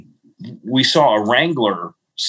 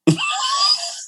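laughing at the memory; high laugh. Real, not acted. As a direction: burst of high squealing laughter. Laughing at own story.

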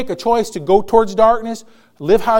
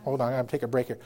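much lower and softer; quiet aside; 10 semitones lower, 13 dB quieter, much faster than usual. Real, not acted. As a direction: drop out of preaching into a low aside. Aside in the middle of preaching.

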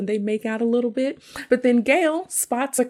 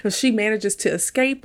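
ordinary talk

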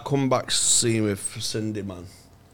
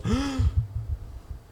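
mock gasp; 11 semitones higher, 9 dB quieter than usual. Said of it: "she goes".

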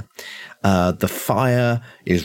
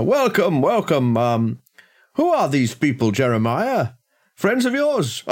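higher-pitched, lilting householder; welcoming host; 6 semitones higher than usual. Acted. Host greets strangers and asks his butler about them, high welcoming voice. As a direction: greet strangers in a raised, genial voice. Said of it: "he comes down the stairs and he says".

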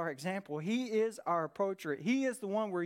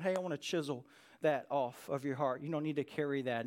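gentle voice of God; 7 semitones lower than usual. Acted. God speaking gently to the heart.

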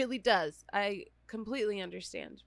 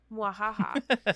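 sneering panto villain; 8 dB louder, slower than usual. Pretend. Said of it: "we were not defeated by the podcast".

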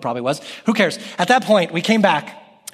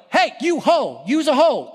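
mock name-calling; 10 semitones higher than usual. Shown as a demonstration. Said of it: "Like, not that".

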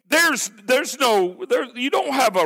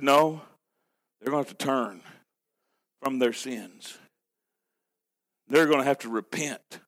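a hint of solemn divine reply; stern voice of God; 7 semitones lower than usual. God answering careless people, low and solemn.

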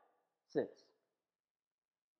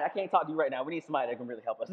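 1 mock panic; 6 semitones lower, much faster than usual. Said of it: "somebody freaking out".

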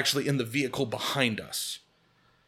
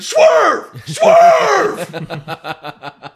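full-throated, high-pitched scream; huge drawn-out yell; 15 dB louder than usual. Retold or imitated. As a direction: scream it out to the driver in panic urgency.

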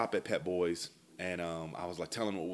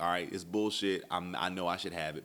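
plain reading voice

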